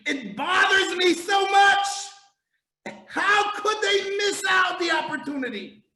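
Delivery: preacher crying out in anguish; impassioned outcry. Real, not acted. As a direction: shout your frustration to the congregation.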